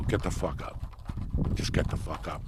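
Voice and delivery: understated, low-key firm order; retold blunt order. Retelling how he told a seatmate to move, understated and blunt. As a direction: give a blunt order without raising your voice. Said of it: "that's exactly how I said it".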